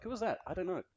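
soft, high puzzled aside; baffled onlooker. Acted. Onlooker after the stranger passes, hushed bewilderment. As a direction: drop to a small, high, bewildered voice. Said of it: "You're like".

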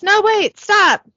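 high, loud panicked yell; acted yell. Driver protests as truck moves on its own, yelling. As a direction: yell for it to stop. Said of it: "Billy yells".

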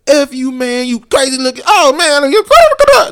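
high, very loud mock rant of an agitated man; shouting mentally ill man. Officer imitating a raving man, shouting high and loud. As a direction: yell it like a raving man on the street. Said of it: "when they're just like".

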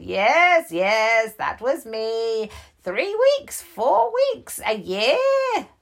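put-on old bear voice.

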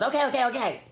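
high, mock-exasperated concession; exasperated giving in. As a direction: imitate a boss reluctantly conceding. Boss gives in to argument.

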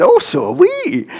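high, slow, half-hearted Glaswegian lady's voice; a self-confessed weak Glaswegian accent, pitched high; attempted Glaswegian lady. Glaswegian lady replying to the preacher, in a high, mock Glaswegian accent. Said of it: "can't do my Glaswegian accent".

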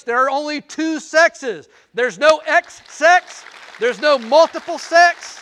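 loud, high, slow declarations; preaching at full force. Acted. Preacher to congregation, forceful slow preaching. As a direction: hammer each line home.

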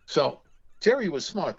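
ordinary talk, nothing marked

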